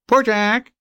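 high, plaintive bird voice; magpie crying. Acted. Magpie pretending to be sleepy and sorry for himself. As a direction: whine it like a pitiful talking bird. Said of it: "and crying".

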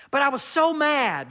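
lightly acted self-justifying protest; protesting excuse. Acting out a churchgoer's excuse.

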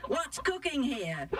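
high-pitched mock shout; storybook shout. A narrator's reading. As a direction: call it out high, without real volume. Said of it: "there was a loud shout".

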